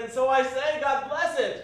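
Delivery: rousing end to a speech; fervent. Acted. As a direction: proclaim it with conviction. Nephew's speech reaches its climax.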